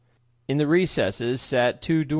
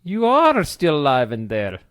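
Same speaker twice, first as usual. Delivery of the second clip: louder, higher through laughter; laughing; 6 semitones higher, 6 dB louder than usual. A narrator's reading. Uncle teasing his brother, laughing loudly. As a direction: laugh while teasing him. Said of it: "William laughed".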